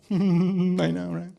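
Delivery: usual speaking voice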